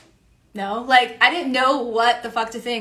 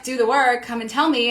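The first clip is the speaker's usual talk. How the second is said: ordinary delivery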